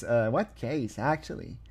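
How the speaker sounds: usual speaking voice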